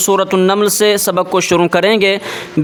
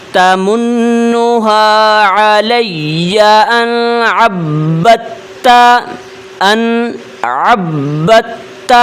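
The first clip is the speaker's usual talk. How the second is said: Quran recitation; slower than usual. Shown as a demonstration. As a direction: stretch each vowel like a reciter. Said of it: "کس طرح پڑھیں گے؟". (آہستہ، بلند آواز میں تلاوت)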